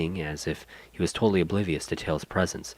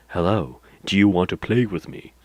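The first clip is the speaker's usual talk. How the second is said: too calm creepy doll; 5 dB louder than usual. Acted. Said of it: "I wanna do that voice".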